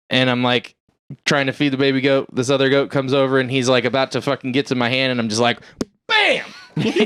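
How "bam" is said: high laughing bam; punch sound effect. Retold or imitated. Punching the greedy goat, high laughing bam. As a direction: sock it with a yelp.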